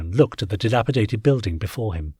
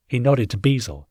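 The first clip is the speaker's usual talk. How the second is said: plain reading voice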